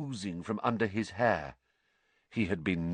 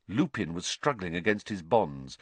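plain reading voice

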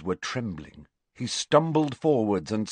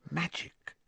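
hushed and higher, lifting at the end; whispered; 8 semitones higher, 10 dB quieter than usual. A narrator's reading. Boy repeating the word to his visitor, whispered. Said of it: "he repeated in a whisper".

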